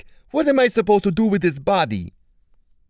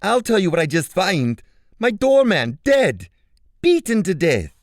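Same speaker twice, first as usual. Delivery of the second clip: high, loud outraged voice; angry Caesar; 8 dB louder than usual. Acted. Caesar angrily reporting his doorman's death. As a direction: throw the news at him in fury.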